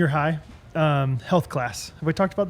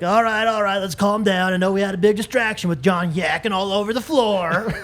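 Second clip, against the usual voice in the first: Coach Hoke impression; 4 semitones higher than usual. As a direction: call the class to order like a gym coach. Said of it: "That's exactly how he said it".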